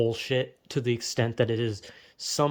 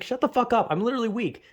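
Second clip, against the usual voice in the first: higher, snapping back at the reassurance; irritated snap; 7 semitones higher, faster than usual. Retold or imitated. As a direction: bite back, fed up and sharp. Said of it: "It's like".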